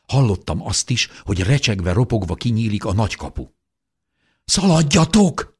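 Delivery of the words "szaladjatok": high, loud cry to run; urgent shout. A narrator's reading. Mother warns the family of attackers, urgent shout. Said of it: "kiáltotta anyám".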